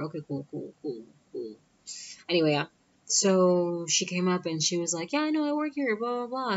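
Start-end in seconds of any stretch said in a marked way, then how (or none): 0.0-1.5 quietly absorbing the news, retold; muted acceptance. Muted reaction to unknown relative. 5.1-6.5 high-pitched imitation of an aunt; imitated excited relative; chirpy greeting. Aunt's chirpy greeting, retold.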